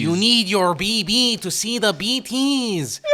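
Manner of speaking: squeaky cartoon-dub director voice, drawn out; game dub of Del Toro. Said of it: "who they got to dub Guillermo del Toro's voice".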